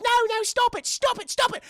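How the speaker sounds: high, loud mock-frantic child voice; panicking little girl. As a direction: plead frantically as a little girl under attack. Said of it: "And she's like".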